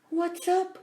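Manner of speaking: high little mouse voice; squeaky mouse voice. Acted. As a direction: talk like a tiny mouse. Mouse character voice.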